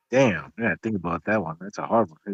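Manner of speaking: a hint of a puzzled stranger mulling it over; mock pondering. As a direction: lightly play someone thinking it over.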